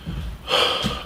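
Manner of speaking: long breathy sigh; acted sigh. Son about to tell Mom his secret, acted sigh. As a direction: let out a soft breath before confessing.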